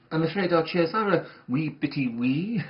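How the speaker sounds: mock Scottish phrase. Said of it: "as they say north of the border".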